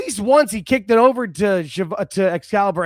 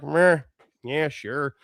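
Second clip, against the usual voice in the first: voicing a half-interested audience, trailing down; unimpressed crowd; 3 semitones lower, much slower than usual. Podcaster voices the crowd behind the wrestler, mildly unimpressed. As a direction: shrug it off with mild indifference. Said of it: "They're like".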